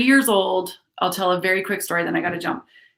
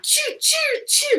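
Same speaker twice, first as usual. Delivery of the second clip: very high imitated web-slinging sound; web-shooting noise; much slower than usual. Boy playing Spider-Man, high web-shooting noise. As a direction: mimic a kid shooting webs. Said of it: "I don't think I did the noise right".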